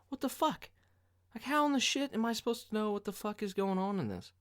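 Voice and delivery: high-pitched, baffled rant; exasperated disbelief. Retold or imitated. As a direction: squeal it in mock outrage. Acted disbelief at a poster.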